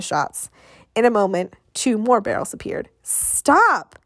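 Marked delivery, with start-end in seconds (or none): 3.0-4.0 higher, drawn-out order to stop; firm, pitched-up order; commanding. A narrator's reading. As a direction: command them to halt. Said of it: "he commanded".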